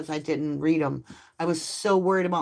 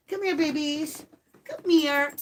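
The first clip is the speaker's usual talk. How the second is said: high sing-song puppy call; 9 semitones higher, 3 dB louder than usual. Real, not acted. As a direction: coax the pups over in a high voice. Calling the puppies over.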